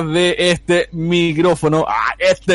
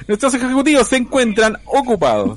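higher, recorded on-hold announcement; hold message voice; 6 semitones higher than usual. Pretend. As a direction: read it like a phone hold message. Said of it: "sonaba como línea de espera".

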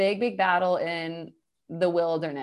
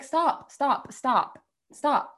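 exasperated stop; 6 semitones higher than usual. Real, not acted. Fed up with the story.

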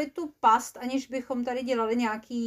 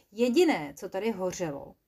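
plain reading voice

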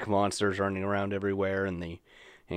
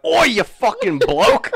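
loud, high, drawn-out Aussie shout; mock Australian bellow; Australian demon; 12 dB louder, slower than usual. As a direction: yell like a hammed-up Australian demon. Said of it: "like, an Australian demon".

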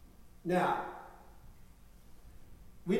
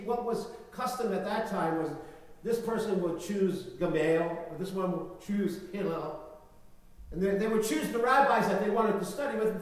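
ordinary talk, nothing marked

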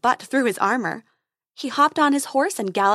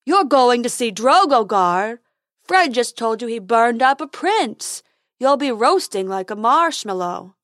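loud, dopey unicorn warning; alarmed silly unicorn; 6 dB louder than usual. Acted. Unicorn warns Dentina about the dragon, loud silly voice. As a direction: warn loudly in a silly creature voice. Plays Fred warning the fairy.

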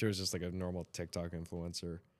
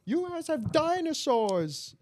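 put-on much higher, louder British accent, slow; British impression; 7 dB louder, slower than usual. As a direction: exclaim in a shocked British accent. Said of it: "that one guy that's always like".

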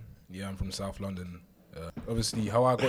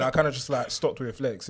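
ordinary delivery

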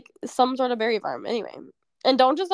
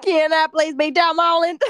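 loud, hammed-up attempt at British; muddled accent; 3 semitones higher, 7 dB louder than usual. Cohost mimicking friend's plea to be Tom, muddled British accent. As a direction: belt out the plea in a fake accent. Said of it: "what is this accent?".

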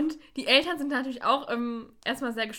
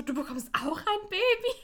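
put-on surprised childish question; 9 semitones higher than usual. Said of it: "Bibi so".

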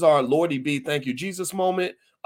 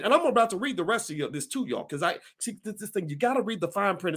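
ordinary talk

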